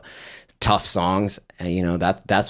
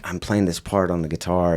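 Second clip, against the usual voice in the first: whispered. Real, not acted. Recalling quiet moment at the table.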